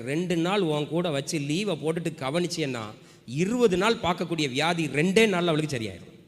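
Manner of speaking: usual speaking voice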